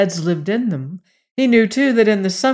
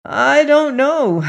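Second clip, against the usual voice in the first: slow, high, loud admission; exasperated, drawn-out reply; emphatic helplessness; 8 semitones higher, 5 dB louder, slower than usual. A narrator's reading. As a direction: draw it out at a loss. Old man answering mother's protest.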